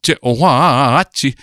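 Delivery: "Je ohuachi" spoken slowly and carefully in Piraha; slow Piraha phrase. Shown as a demonstration. Linguist contrasting the Piraha we-form with the command, slow careful pronunciation. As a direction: draw out "Je ohuachi" slowly, each vowel clear. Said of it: "We should all look for this".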